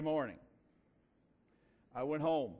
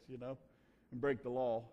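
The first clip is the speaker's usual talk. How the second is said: usual speaking voice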